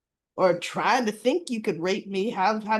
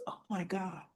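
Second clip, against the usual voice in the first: much softer, muttered under breath; exasperated hush; 3 semitones lower, 14 dB quieter than usual. Retold or imitated. Allison reacting to Chelsea's denial, muttered quietly. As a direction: sink into disbelief, softly. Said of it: "she goes".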